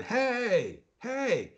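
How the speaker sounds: high mock call after a man; calling out to stop him; high hey hey, half yelled; retold yell.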